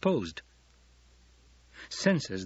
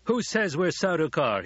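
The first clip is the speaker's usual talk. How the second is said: high, raised challenge from a cornered soldier; demanding; 7 semitones higher, 3 dB louder than usual. A narrator's reading. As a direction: demand it defiantly. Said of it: "he demanded".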